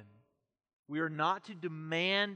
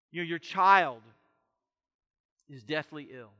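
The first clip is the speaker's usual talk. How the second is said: usual speaking voice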